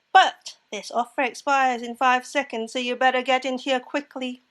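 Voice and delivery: loud, deadpan ultimatum on an even pitch; Wednesday Addams type. Acted. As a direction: press the offer firmly and without emotion. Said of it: "She reminded me of Wednesday Addams".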